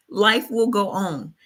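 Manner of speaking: ordinary talk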